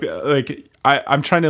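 ordinary talk

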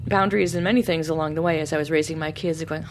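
ordinary delivery

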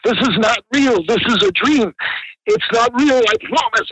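high-pitched panicky pleading; imitated crying. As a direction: imitate a terrified man wailing his excuses. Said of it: "And he's crying".

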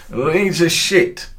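put-on high, loud, drawn-out drunk jeer; retold drunk fan. Drunk Hearts fan taunting a Leeds supporter, high and drawn out. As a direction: stretch it out like a loud drunk heckler. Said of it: "he said".

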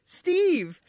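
high-pitched retold shout-back; neighbor's correction. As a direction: toss the name back high, imitating a neighbor. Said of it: "the neighbor goes".

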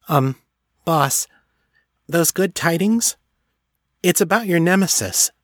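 hesitant high-pitched female elf; Clippy. A narrator's reading. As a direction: pipe up tentatively to the boss in a high little voice. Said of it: "cleared her throat".

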